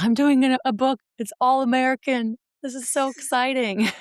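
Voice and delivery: put-on high, gushing excitement in her old accent; excited, past accent. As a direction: squeal it out, bubbly and high-pitched. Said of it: "Even though I sound like this now".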